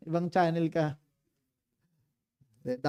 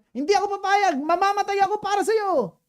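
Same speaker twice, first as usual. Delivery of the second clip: much higher, louder, pleading protest; Peter protesting; 14 semitones higher, 8 dB louder than usual. Retold or imitated. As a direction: play Peter swearing loyalty, high and urgent.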